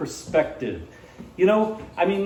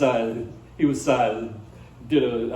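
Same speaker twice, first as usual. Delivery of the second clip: usual speaking voice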